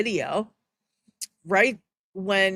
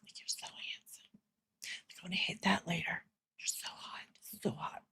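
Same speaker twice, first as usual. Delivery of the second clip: whispered mock seduction, much softer; flirty whisper; 17 dB quieter than usual. Mocking her fawning over Charlie, sultry whisper. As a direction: breathe it like a sultry secret. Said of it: "She's like".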